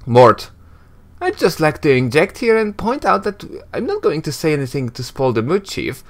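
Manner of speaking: high, chirpy sidekick voice; cheeky floating skull. Acted. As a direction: pipe up as a cheeky spirit.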